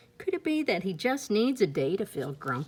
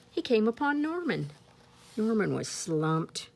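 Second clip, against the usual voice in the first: plain reading voice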